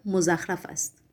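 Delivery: low, soft blunt honesty; frank and bold. A narrator's reading. Poet judges his own poems, frankly. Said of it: "با صداقت و جسارت جواب داد".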